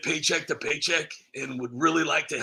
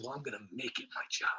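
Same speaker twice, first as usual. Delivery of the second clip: ordinary talk, nothing marked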